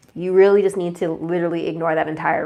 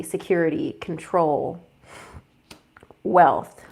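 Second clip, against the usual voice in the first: normal talking voice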